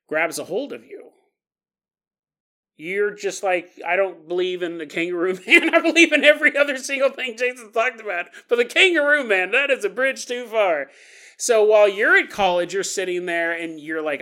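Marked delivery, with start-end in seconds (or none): 3.9-10.9 emphatic denial. Acted. Skeptic student declaration.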